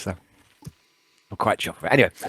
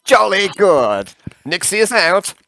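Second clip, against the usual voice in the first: put-on high, hearty old-chap character; slow, loud "jolly good" flourish; [name], jovial sign-off; 8 semitones higher, 4 dB louder, much slower than usual. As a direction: sign off with hammy good cheer. [name] signs off.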